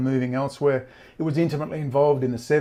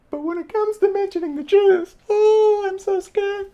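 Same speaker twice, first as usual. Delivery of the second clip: much higher, whiny mock fear with a fake cry; mock scared; 6 dB louder than usual. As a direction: squeak like a frightened sissy to mock them. Mocking cowards afraid of topic.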